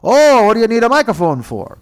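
high, loud nosy guard voice; pry like a suspicious official. Retold or imitated.

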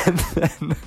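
ordinary talk

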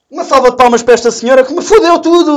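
loud sarcastic call for applause; mock announcement. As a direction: announce it like a showman. (anúncio alto e sarcástico)

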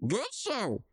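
put-on high, posh old gent; Pops, high and cheerful. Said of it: "it says".

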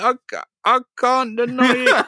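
high, hammed-up whine; mock-helpless confession. Cornered young man confesses to his girlfriend, high mock whine. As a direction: stammer it high, comically cornered.

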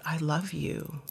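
whispered softly to his crying daughter; tender whispered I love you. Retold or imitated. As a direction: breathe it gently. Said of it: "he just said".

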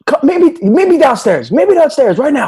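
high, loud yelled call across campus; hammed-up shout from far away; mock yell. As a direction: holler it like across a quad. Retold yelling across campus.

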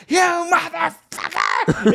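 long, high mock shriek; imitated screaming. Imitating woman screaming on a busy Sunday, high mock shriek. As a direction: stretch it out in a hammed-up screech. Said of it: "looking at her screaming".

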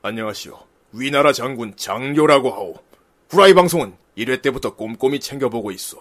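low, measured voice of an ancient general; cool general's reading. Acted. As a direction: read the letter with stately, dashing gravity. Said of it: "이거 좀 멋있게 읽어야겠다".

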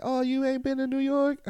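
high mocking "you ain't been?"; high mock disbelief; surprised relative. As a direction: play the shocked cousin. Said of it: "people will be talking like".

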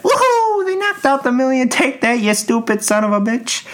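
gleeful cheering. Retold or imitated. Gloating at greedy contestant who lost, high cheering. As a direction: crow over the loser's fall. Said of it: "Where I'd be like".

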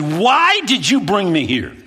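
high, loud, slow frantic shout; panicked demand. Retold or imitated. To his friend in the hot village, a slow frantic shout. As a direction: demand an answer, losing it.

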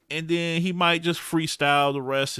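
ordinary delivery

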